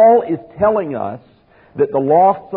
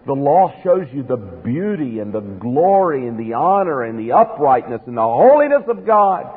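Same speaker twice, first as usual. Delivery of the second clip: usual speaking voice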